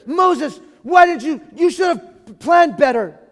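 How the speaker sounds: loud, high blaming shout; angry at Moses. Retold or imitated. People shouting angrily at Moses over poor planning. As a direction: yell the blame at Moses. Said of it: "Mad at others".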